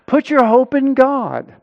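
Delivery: high, urgent exhortation to oneself; self-exhortation. Acted. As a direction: urge your own soul insistently. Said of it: "So he's exhorting himself".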